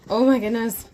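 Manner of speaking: a real squeal of excitement at the doll; excited burst. Real, not acted. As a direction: let it explode with delight. Overwhelmed unboxing the doll.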